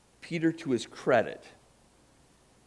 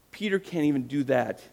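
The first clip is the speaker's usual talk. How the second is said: normal talking voice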